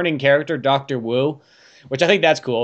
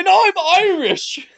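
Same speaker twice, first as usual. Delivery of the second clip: high, slow, hammed-up Irish guy; mock Irish guy; 4 dB louder, slower than usual. Riffing on the park staff, proud hammed-up Irish guy. Joke voicing Irish character.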